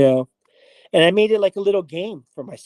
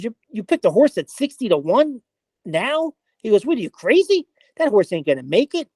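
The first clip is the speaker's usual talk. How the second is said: incredulous friend; 7 semitones higher than usual. Retold or imitated. Friend mocking a long-shot bet.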